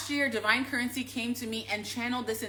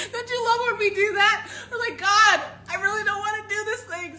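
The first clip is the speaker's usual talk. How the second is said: high, laughing through words; laughing at her own fear, loud and high; 11 semitones higher, 7 dB louder than usual. Real, not acted. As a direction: crack up while saying it. Laughing at herself.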